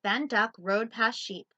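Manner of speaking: lively loud picture-book read; reading to children. Acted. Teacher reading Duck on a Bike to children. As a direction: read it out big for little listeners.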